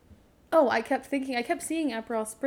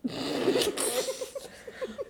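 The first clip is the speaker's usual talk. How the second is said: high, giggling laugh. Real, not acted. Laughing about the podcast.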